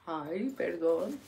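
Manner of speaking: soft, slow and low; meek apology. Retold or imitated. Daughter tiptoeing around her quiet dad, meek and hushed. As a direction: tiptoe around it with a small, careful sorry. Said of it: "si está bien calladito, andas like".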